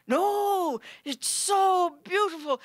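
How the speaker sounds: high, slow, wondering voice; awed guest. Retold or imitated. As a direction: marvel at it like a child. Guest in awe.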